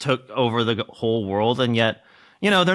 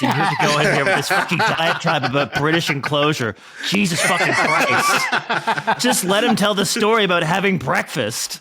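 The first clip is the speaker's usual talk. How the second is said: higher-pitched comic scolding through laughter; mock exasperation; 6 semitones higher than usual. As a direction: scold a friend for hijacking the story. Comic scolding of long digression.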